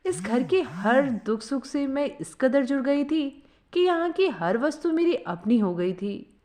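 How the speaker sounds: ordinary delivery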